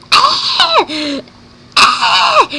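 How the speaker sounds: high, loud mock screams; nightmare scream. Bella wakes from a nightmare, shrieking high and loud. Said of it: "scream like that".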